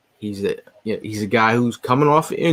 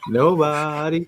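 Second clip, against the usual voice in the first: drawn-out echo; 6 semitones higher, much slower than usual. Real, not acted. Echoes co-host mockingly.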